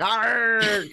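"Arg" as an angrier pirate cry; drawn-out, playful pirate "Arg"; angry pirate growl. Shown as a demonstration. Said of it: "I say it a little angrier".

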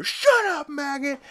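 high, explosive yell; furious mock shout. Joe shouting down Lois as he punches her, furious mock yell. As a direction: cut in with a sudden shouted order.

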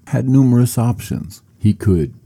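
ordinary delivery

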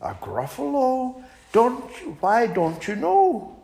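high, polite little mouse turning down an invitation; small and prim, declining sweetly; a high, prim mouse fibbing to the owl. Acted. Mouse describes gruffalo.

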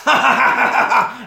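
loud, high mocking cackle; put-on villain's laugh; imitated laugh. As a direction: act out his big laugh. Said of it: "and he laughs".

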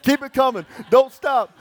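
high, loud revival shout; mock yell. Pastor retelling cheering on the waiters, loud high mock amen yell. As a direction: holler it like an amen corner. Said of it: "I'm yelling, right?".

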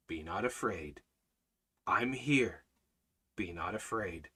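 hushed, low and steady comfort; reassuring Almighty Father. Acted. God to his frightened children, hushed and reassuring. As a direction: soothe their fear very softly. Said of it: "What does our, our Almighty Father say?".

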